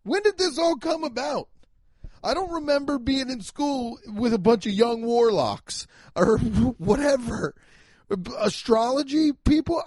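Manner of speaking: incredulous. Real, not acted. Incredulous rant about astrology.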